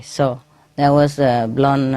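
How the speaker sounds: usual speaking voice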